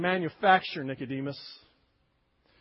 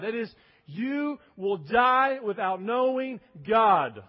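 usual speaking voice

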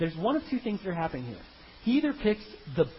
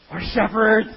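"shepherds" shouted much louder; blurted out; 3 semitones higher, 10 dB louder, slower than usual. Retold or imitated. Brothers blurting the forbidden word to Pharaoh, loud.

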